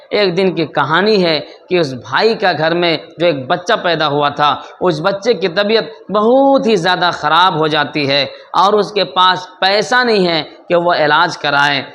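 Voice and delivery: usual speaking voice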